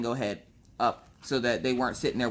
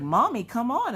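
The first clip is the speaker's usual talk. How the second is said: put-on kid's voice; 9 semitones higher than usual. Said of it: "the kids are, like, giggling and laughing".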